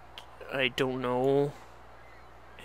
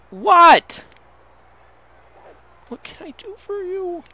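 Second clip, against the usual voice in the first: much higher and louder, near a cry of despair; anguished shouting; 12 semitones higher, 8 dB louder, slower than usual. Real, not acted. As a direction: crack into a desperate wail at them. Breaking down yelling at the wolves.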